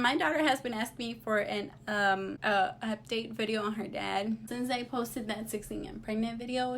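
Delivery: ordinary talk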